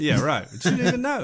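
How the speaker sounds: high, laughing echo of the joke; giggling through the words. Real, not acted. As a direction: crack up while repeating the line.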